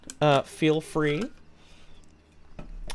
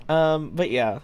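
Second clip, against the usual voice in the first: ordinary talk, nothing marked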